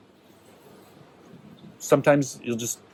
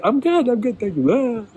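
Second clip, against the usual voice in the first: chirpy brush-off; 11 semitones higher than usual. Retold or imitated. Reassures friend while crying.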